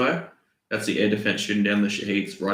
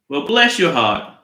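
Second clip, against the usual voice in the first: slow, loud fake Southern drawl; Southern accent; 3 semitones higher, 5 dB louder than usual. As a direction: stretch it out in an unconvincing Southern accent. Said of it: "That's not really a Southern".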